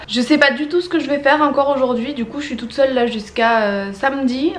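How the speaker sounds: usual speaking voice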